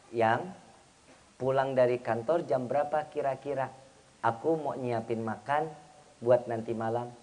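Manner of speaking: soft, low, coaxing voice; sweet wife. Acted. Wife planning dinner for husband, softly and lovingly. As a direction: ask tenderly, in an unhurried hush. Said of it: "Tergantung intonasi atau yang dipakai".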